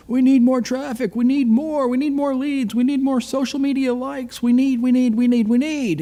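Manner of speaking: high, insistent mock demands; nagging dealer impression. Friend mimics his dealer hounding him, high-pitched mock nagging. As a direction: mimic a boss badgering with relentless repetition. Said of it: "The dealer's all over me about".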